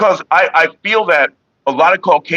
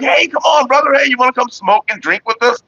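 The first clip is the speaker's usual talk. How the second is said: calling a buddy over, much higher; friendly invite; 10 semitones higher than usual. Retold or imitated. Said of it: "They're like".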